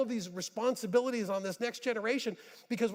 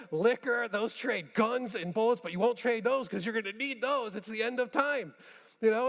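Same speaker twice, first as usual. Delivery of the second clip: higher, raised preaching voice; preaching at full force; 5 semitones higher than usual. Acted. Preacher mocking gold hoarders to his congregation, fired up. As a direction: drive the point home to the room.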